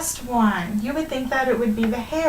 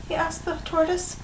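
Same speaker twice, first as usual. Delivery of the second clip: ordinary delivery, nothing marked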